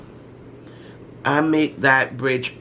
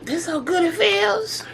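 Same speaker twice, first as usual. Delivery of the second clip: giddy delight; 5 dB quieter, slower than usual. Retold or imitated. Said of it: "I was like".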